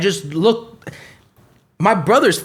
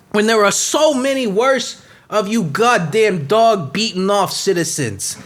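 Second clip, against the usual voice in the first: raised, outraged officer's tirade; indignant rant; 4 semitones higher than usual. Acted. Cop character defending a fellow officer to his critics, indignant.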